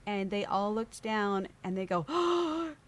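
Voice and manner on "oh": gasp, then high, slow lament; acted dismayed commiseration; imitating shocked sympathy. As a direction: gasp and draw it out in mock horror. Police realise what was broken.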